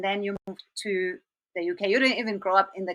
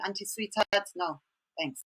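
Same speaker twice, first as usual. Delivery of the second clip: usual speaking voice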